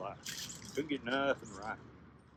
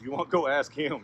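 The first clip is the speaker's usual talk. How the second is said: loud, raised, drawn-out caution; urgent warning; 5 semitones higher, 9 dB louder than usual. Acted. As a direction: warn a friend off slowly and firmly. Warns Rabbit off the man.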